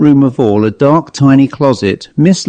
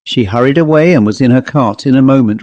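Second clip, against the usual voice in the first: plain reading voice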